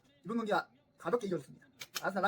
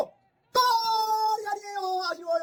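drawn-out commentator shout; much slower than usual. Real, not acted. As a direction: stretch it like a TV commentator. Goal disallowed, commentator style. (중계하듯 길게 외치는 외침)

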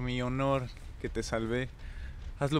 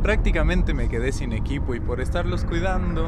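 loud exasperated outburst; frustrated yelling; 4 semitones higher, 10 dB louder, slower than usual. Real, not acted. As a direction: yell in real frustration.

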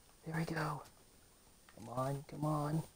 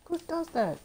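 panicked yell; 9 semitones higher than usual. Real, not acted. Gamer yelling at the boss not to attack. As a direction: shout it at the screen in disbelief.

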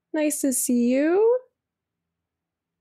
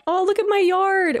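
delighted; 6 semitones higher than usual. Real, not acted. Delighted seeing her farm.

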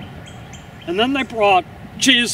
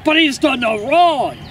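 raised, higher-pitched objection; pleading protest; 6 semitones higher, 4 dB louder than usual. Acted. An onlooker answering the crowd's cries, protesting urgently. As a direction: protest over the noise of a mob.

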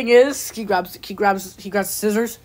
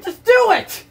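sharp high yelled command; shouted order; 10 semitones higher, 4 dB louder than usual. Acted. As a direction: bark the order at him. Bossing Luigi into it.

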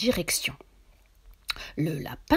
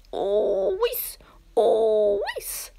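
rising "oh" heave ending in "whis"; straining pull; 12 semitones higher, much slower than usual. Acted. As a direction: heave hard, then let it slip. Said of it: "de toutes ses forces". (ahanements d'effort en tirant de toutes ses forces)

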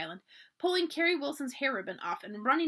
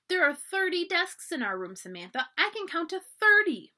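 put-on high, louder child bursting with news; excited little girl; 7 semitones higher, 5 dB louder than usual. A narrator's reading. Little sister telling Samantha about her first school day, excited. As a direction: pipe up high with eager excitement. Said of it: "began jumping up and down".

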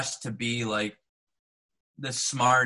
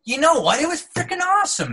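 excited; 4 dB louder than usual. Real, not acted. Enthusing about the biography.

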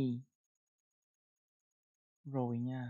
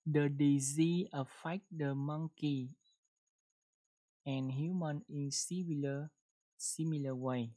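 slow model reading. Shown as a demonstration. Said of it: "chúng ta đọc một câu nha".

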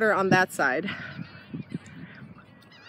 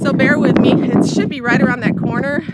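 raised voice over the wind; talking over wind; 3 semitones higher, 7 dB louder than usual. Real, not acted. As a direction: speak up loud over the wind. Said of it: "if you can't hear me, I'm sorry".

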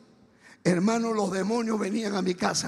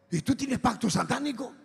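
pregunta incrédula; 4 semitones higher than usual. Retold or imitated. Acting friends' incredulous question.

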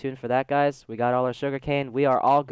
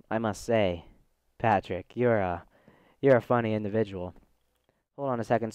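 usual speaking voice

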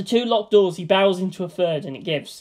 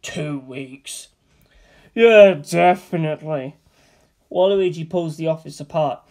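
plain reading voice

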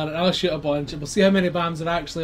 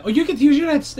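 high, loud outburst; excited; 10 semitones higher, 8 dB louder than usual. Real, not acted. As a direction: blurt it out, thrilled. Jealous outburst in game.

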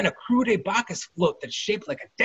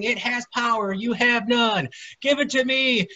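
shouting; 6 semitones higher than usual. Acted.

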